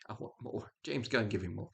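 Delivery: very soft, almost whispered wish; under the breath. Real, not acted. Viewer let down by an okay film, quietly wishful. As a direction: let it fade to a near whisper.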